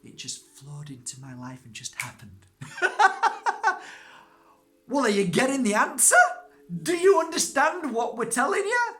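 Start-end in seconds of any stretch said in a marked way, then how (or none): 2.6-4.2 a big high burst of laughter; loud high laugh. Real, not acted. Bursts out after rhetorical questions. 4.9-8.9 high, loud, fast playful challenge; excited teasing. Real, not acted. Teasing audience, preaching answer.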